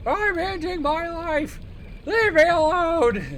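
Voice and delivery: put-on high, loud, slow shouting old man. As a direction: wail it like a dramatic grandpa. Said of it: "the old man voice".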